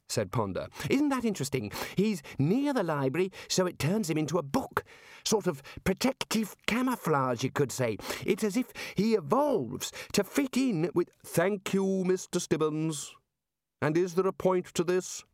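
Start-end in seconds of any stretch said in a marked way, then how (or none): 0.9-11.1 high-pitched, fascinated explainer; excited boffin voice, pitched well up; eager young academic wizard. Acted. 11.2-15.3 high, deliberate, testy put-down; exasperated head wizard, pitched up; impatient interruption. Acted. Ridcully interrupts Ponder's lecture.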